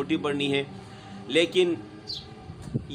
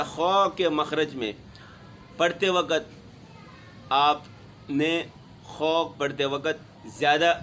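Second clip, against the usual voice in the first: ordinary delivery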